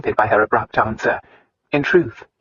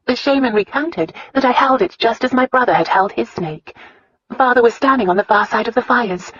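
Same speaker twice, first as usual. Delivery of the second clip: put-on higher-pitched wife telling a childhood tale; earnest young wife recounting; Christina's voice; 8 semitones higher than usual. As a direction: lift the pitch, earnest storytelling heroine. Voices Christina telling her story.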